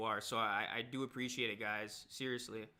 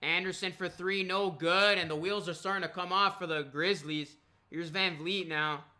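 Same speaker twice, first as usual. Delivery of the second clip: live play-by-play; 2 semitones higher, 5 dB louder than usual. Acted. Live game commentary.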